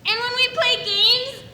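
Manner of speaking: bawling, high, very loud wail; shouting and crying. Acted. Child wailing grievances at gum sample lady, shouting and crying. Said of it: "so I'm shouting and I'm crying".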